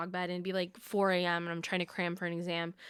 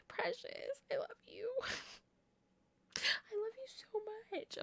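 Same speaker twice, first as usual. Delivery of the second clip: soft tender whisper, much softer and higher; whisper to the dog; 13 semitones higher, 11 dB quieter than usual. Real, not acted. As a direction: breathe it close to the dog's ear. Tender words to her dog.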